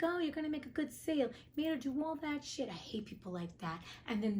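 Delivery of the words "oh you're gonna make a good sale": high, fast mocking voice; mocking the tailor. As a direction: mimic him with a sneer, quick and high. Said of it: "He's like".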